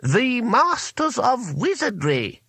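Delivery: put-on grand, high, drawn-out old wizard voice; haughty. A narrator's reading. Wizard announcing the masters through the door, haughty. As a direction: announce it slowly and loftily, pitched high. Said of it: "said the voice haughtily".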